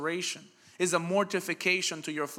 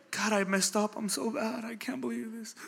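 mock guilty lament; 5 semitones higher than usual. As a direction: whine it like a guilt-ridden believer losing heart. Acted guilty believer's prayer.

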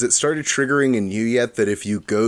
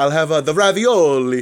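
dad's hammed-up Italian accent, higher and louder; mock Italian accent; 6 semitones higher, 5 dB louder than usual. Dad ordering at Olive Garden, exaggerated Italian accent. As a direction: put a showy fake Italian on "ravioli". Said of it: "try and say the words".

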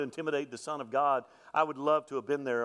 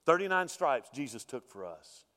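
ordinary talk

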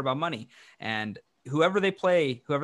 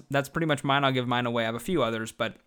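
ordinary delivery

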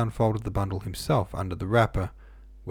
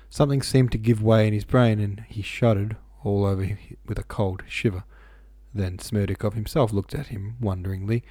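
plain reading voice

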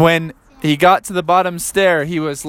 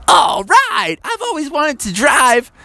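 eager tiny frog; 10 semitones higher, slower than usual. Acted. Frog gets into jeep.

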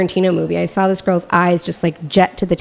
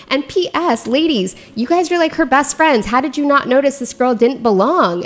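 teasing reproach, pitched high; mock scolding; 6 semitones higher than usual. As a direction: stylist scolds the bridesmaids for missing the stranger. Scolding the bridesmaids.

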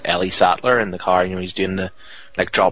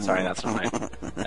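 ordinary delivery

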